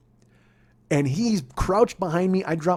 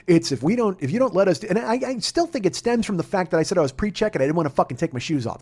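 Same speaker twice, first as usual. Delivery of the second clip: normal talking voice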